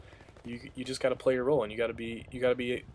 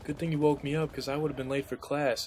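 higher, breezy voice of the cousin, unbothered; nonchalant cousin; 4 semitones higher than usual. Retold or imitated. Cousin caught with a girl, acting casual, higher and breezy. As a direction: shrug it off like nothing happened. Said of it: "and he's like".

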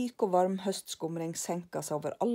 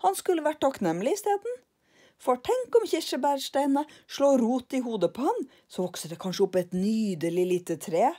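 higher, louder cheeky fantasy; gleeful Karlsson; 7 semitones higher, 6 dB louder than usual. Acted. Karlsson jokes to lillebror about a cherry tree, higher and louder. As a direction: spin it with glee.

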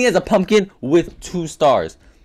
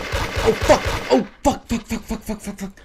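panicked cursing; 8 semitones higher than usual. Real, not acted. Panicking in game.

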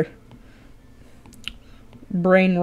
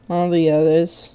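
ordinary talk, nothing marked

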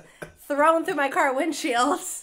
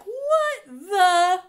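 shocked; 7 semitones higher, 5 dB louder than usual. Retold or imitated. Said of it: "I was like".

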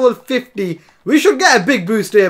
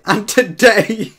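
laughing; much slower than usual. Real, not acted. Nervous laugh before big match.

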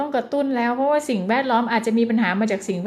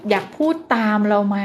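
usual speaking voice

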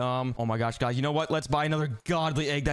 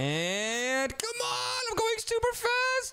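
straining; much slower than usual. Real, not acted. Straining to win fight.

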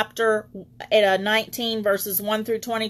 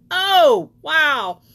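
amazed exclamation; 5 semitones higher, 6 dB louder than usual. Real, not acted. Preacher marvels at angel's promise.

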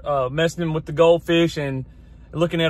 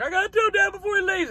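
lightly imitating a little boy, much higher and a bit softer; son's voice; 12 semitones higher, 3 dB quieter than usual. Quoting his son rushing to catch Dad, mock child voice. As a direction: pitch up into a small kid's urgent plea. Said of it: "So he was like".

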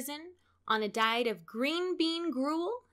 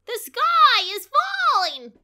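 much higher, loud slow cry; chorused alarm; 12 semitones higher, 13 dB louder, slower than usual. A narrator's reading. Birds answer the officer, loud and high in chorus. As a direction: shout it together in panic. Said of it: "chorused the birds".

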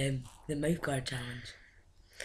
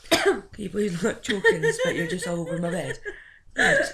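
laughing; 7 semitones higher, 6 dB louder than usual. Real, not acted. After a coughing fit.

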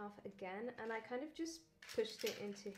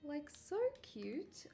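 gushing; 5 semitones higher, 6 dB louder than usual. Real, not acted. Gushing over cute muffins.

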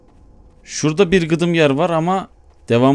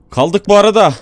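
higher and louder, startled; surprised; 7 semitones higher, 7 dB louder than usual. Real, not acted. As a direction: blurt it out in surprise. Stuck in the game.

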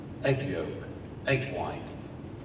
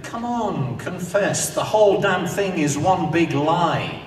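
loud, much higher accusing call; demanding confession; 6 semitones higher, 7 dB louder than usual. Acted. Narrator accusing the pieman of lying, in a loud, hammed-up demand. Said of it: "And so I say".

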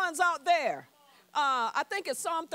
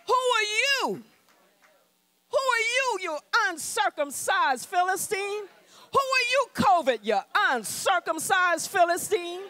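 defiant challenge; 4 semitones higher than usual. Retold or imitated. David shouts defiance at the giant and at covid. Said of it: "That's how you need to talk".

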